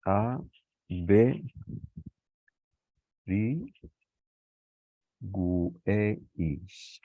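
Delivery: each letter of averigüéis named slowly with pauses; spelling out letters. Shown as a demonstration. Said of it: "tenemos aquí averigüéis". (deletrear averigüéis letra a letra)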